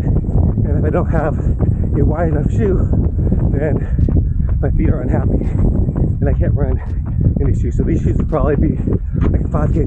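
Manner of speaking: while running. Real, not acted. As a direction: speak while jogging on a run. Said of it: "after mile one in the shoe".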